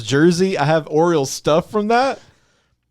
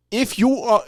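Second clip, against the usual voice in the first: put-on slight slip into a Boston accent; a hint of Boston accent on it; 10 semitones higher than usual. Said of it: "I almost slipped into a little Boston there".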